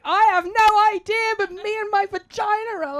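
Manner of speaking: high, loud and slowed, glowing with power; giddy boast. Retold or imitated. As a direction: savour each word, buzzing with new confidence. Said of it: "And I'm like".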